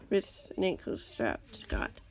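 ordinary delivery

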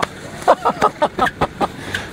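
high, breathy burst of laughter; real excited cackle; delighted laugh. Real, not acted. Laughs after hooking the fish.

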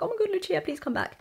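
high, panicky cry for her friend; panicked plea. Retold or imitated. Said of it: "I ran up and locked the door and I was like".

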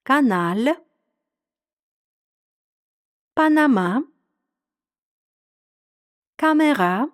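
"Каналь, Панама, камера" word by word, French stress on the last syllable; model pronunciation. Shown as a demonstration. French teacher reads words for learners to repeat, final stress on "Каналь, Панама, камера". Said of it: "Я читаю, ви повторюєте".

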